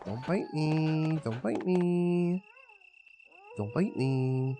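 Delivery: drawn-out slow plea; slow pleading. Real, not acted. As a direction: stretch it out, begging.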